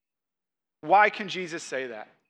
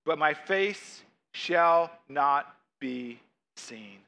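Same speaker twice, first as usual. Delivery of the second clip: slow, lower, word-by-word close; grave scripture reading; 3 semitones lower, much slower than usual. Acted. Preacher ends God's warning to Moses, slow and grave. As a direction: weigh every word with pauses.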